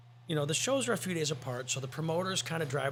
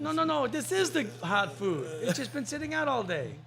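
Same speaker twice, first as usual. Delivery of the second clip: put-on Korean accent; 9 semitones higher than usual. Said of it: "I love your Korean accent".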